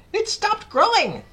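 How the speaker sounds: very high, loud, slow exclamation; Aunt Spiker crying out. A narrator's reading. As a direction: cry out in astonishment. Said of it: "Aunt Spiker cried".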